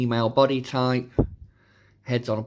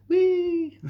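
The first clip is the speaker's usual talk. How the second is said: playful whee; 4 dB louder than usual. Pretend. Playful cheer for the effect.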